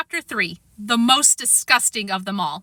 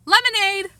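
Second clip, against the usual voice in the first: calling out; 11 semitones higher than usual. Acted.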